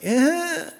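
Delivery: small high-pitched "eh"; mock whimper. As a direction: imitate a kid's squeak at a needle. Son's reaction to needle, imitated.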